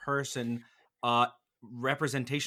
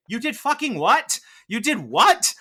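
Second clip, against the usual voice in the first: high, loud disbelieving outcry; incredulous shock; 8 semitones higher, 9 dB louder than usual. Retold or imitated. A sane listener hears something crazy, loud aghast disbelief. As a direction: demand an explanation, aghast.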